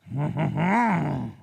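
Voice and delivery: nasal-free "haw-haw-haw" French chuckle; slow hammed-up French laugh; mock French laugh. Streamer imitating the French, exaggerated haw-haw laugh. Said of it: "Has a French person ever made that noise?".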